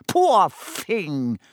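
mock sympathy, high and oily; Peeves, unconvincing pity. A narrator's reading. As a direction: feign pity without meaning it. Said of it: "he added unconvincingly".

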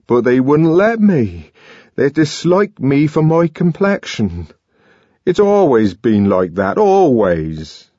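aggrieved lament; bitter hurt. A narrator's reading. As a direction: fight back tears. Young man near tears.

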